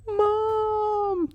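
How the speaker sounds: very high cry for mom; child's scream. Acted. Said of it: "the boy screamed".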